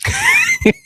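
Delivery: a short mocking burst of laughter; a high, scoffing laugh. Real, not acted. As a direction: laugh at the chant in disbelief. Mocking the rally chant clip.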